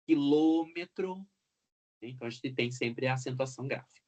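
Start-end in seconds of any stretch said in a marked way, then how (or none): 0.1-1.3 quilômetro slowly, with a strong LÔ; quilômetro drawn out to show the stressed LÔ; stress on antepenultimate syllable. Shown as a demonstration. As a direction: stretch the LÔ in quilômetro. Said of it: "o acento recai na antepenúltima sílaba".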